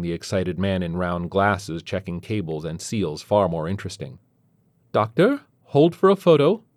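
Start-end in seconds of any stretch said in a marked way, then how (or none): 4.9-6.6 high, loud, eager request; woman calling out. A narrator's reading. Middle-aged woman asks doctor for a photo, calling out. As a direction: voice a woman calling up to a busy man. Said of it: "asks a middle-aged woman in a fur coat".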